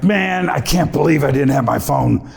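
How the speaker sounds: ordinary talk, nothing marked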